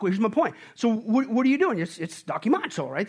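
normal talking voice

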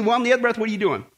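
ordinary talk, nothing marked

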